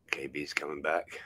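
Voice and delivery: quick hushed warning; whispering. Real, not acted. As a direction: whisper before they hear you. Warning quietly before friend returns.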